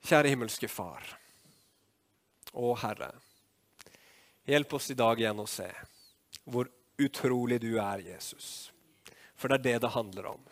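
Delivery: earnest prayer. Real, not acted. Preacher praying to the Father for the congregation, earnest prayer.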